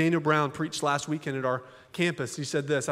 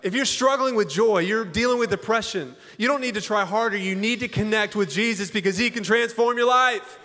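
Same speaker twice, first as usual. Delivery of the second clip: raised, driving sermon voice; forceful preaching; 6 semitones higher than usual. Acted. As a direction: push it hard like a pastor at the climax.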